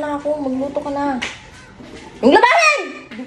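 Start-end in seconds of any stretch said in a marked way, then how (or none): 2.2-2.8 high, loud playful order; mock bossy shout. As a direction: bark the chore at him in fun. Ordering partner to do chores.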